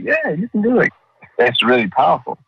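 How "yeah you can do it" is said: high, eager mock encouragement; lightly hammed-up pep talk; encouraging cheer. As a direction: cheer them on in a raised voice. Cheering the newcomer on.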